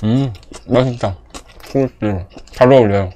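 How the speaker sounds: chewing while talking; covering mouth while chewing; mouth full of burger. Real, not acted. Seen 1: Cheeks puffed, chewing, hand over mouth until 2.8s; later holds burger.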